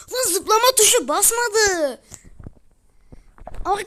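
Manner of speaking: high, loud, slow angry complaint; furious gamer cry, slowed down; frustrated outburst. Real, not acted. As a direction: yell at the broken jump button. Jump button failed, angry gamer.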